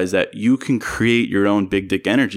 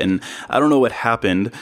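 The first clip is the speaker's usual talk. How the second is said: usual speaking voice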